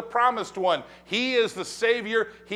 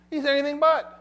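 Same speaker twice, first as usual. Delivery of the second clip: high, louder ironic quip; deadpan punchline on Peter; wry aside; 8 semitones higher, 4 dB louder than usual. Real, not acted. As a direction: toss it off with a knowing twist. Quip after naming Peter.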